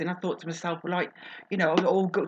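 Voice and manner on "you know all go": ordinary talk, nothing marked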